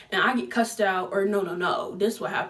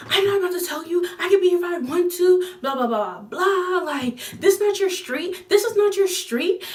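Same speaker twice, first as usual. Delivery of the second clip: high, loud sassy retort; defiant teen; 8 semitones higher, 4 dB louder than usual. Retold or imitated. Retelling Susie sassing the grown woman, high and defiant. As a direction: talk back high and sassy.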